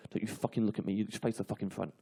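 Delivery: low, hushed threat; quiet menace through teeth; menacing mutter. Retold or imitated. Lad threatening on bus.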